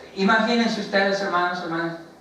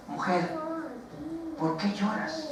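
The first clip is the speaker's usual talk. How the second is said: gentle question; 9 semitones higher, 10 dB quieter than usual. Retold or imitated. Jesus asks the weeping Mary.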